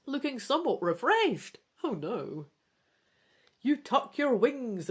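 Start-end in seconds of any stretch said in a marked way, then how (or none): none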